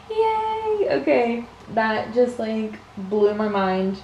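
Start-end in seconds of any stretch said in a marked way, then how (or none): 0.1-0.9 high little cheer. Real, not acted. Cheering at pin.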